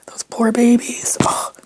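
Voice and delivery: a hushed whisper for the koalas; pitying whisper. Real, not acted. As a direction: whisper it with pity.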